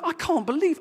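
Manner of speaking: high-pitched indignant outburst; worked-up, incredulous protest; angry retelling. Retold or imitated. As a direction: re-enact your own exasperation. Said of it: "I was really angry".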